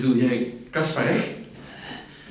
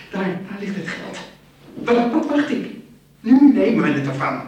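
excited; 8 semitones higher, 5 dB louder than usual. Acted. Greedy plan to take money.

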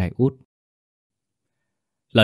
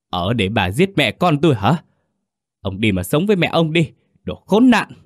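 trembling furious yell; 6 semitones higher than usual. Acted. Husband yelling back at his wife, shaking with fury. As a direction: explode with rage on the last insult. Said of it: "quát lại run lên vì tức giận".

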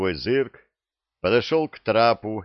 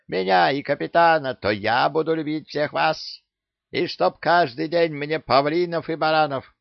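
shrieking parrot; 9 semitones higher than usual. A narrator's reading. Parrot threatening the pirate crew, high shrieking. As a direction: screech it at the whole crew. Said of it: "истошный крик попугая".

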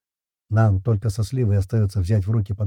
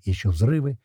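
ordinary delivery

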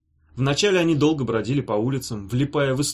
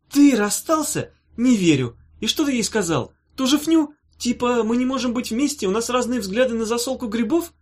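mocking disbelief; 11 semitones higher, 4 dB louder than usual. A narrator's reading. Nata mocks him laughing.

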